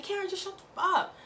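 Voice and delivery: high, fast, irritated snap; mock angry snap. Pissed [name] snapping at Karen, high and fast. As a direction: shut her down fast and high.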